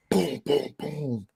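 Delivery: high, hollow booms for door knocks; door-pounding imitation. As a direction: imitate someone beating a door down. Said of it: "like, the beating the door down".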